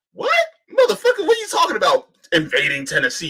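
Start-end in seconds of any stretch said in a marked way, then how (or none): 0.1-2.1 real disbelief, voice flying up on motherfucker; incredulous outburst. Real, not acted. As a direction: pitch jumps way up in disbelief. Incredulous outburst at absurd claim.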